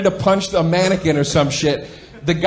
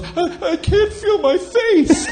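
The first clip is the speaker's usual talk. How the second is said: high, slow, stunned little voice; dazed injured girl; slower than usual. Retold or imitated. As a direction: play the dazed girl hit by a watermelon. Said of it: "the girl goes".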